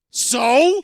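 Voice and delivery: high, loud, scornful "So?"; loud retold "So?". Host retelling his reaction to the flagrant review, loud and scornful. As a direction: fling it out, incredulous. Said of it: "And I'm like".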